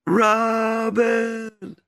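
high, drawn-out call of the name; distant call. Shown as a demonstration. Showing the Lord the call again, high and drawn out. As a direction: call it out as from far off. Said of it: "Show me".

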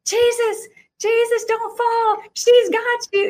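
put-on very high pleading kids' cry; alarmed children calling. Children calling to Jesus not to fall, high and pleading. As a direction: call out like scared kids. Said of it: "the children are like".

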